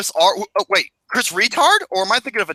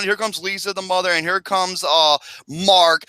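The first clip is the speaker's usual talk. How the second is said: ordinary talk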